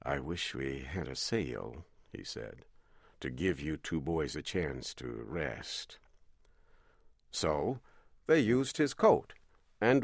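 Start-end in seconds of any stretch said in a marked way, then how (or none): 0.0-1.8 low, soft captain voice; low calm captain. A narrator's reading. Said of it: "He always spoke in a low voice and calmly". 3.2-6.0 low, soft captain voice; kindly and low, as the captain; low calm captain. A narrator's reading. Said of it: "He always spoke in a low voice and calmly".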